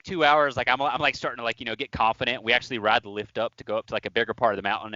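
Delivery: ordinary talk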